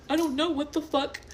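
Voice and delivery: high-pitched mock indignation; high, put-on imitation of a woman arguing; imitating arguing woman. Imitating woman in heated argument.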